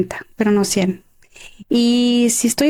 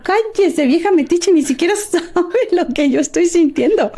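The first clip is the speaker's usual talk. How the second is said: higher-pitched mock snapping back; retold irritated retort; 6 semitones higher than usual. Crying graduate answers the meddling lady, mock-irritated retort. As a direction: replay an indignant brush-off, half laughing. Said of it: "yo llorando con una rabia".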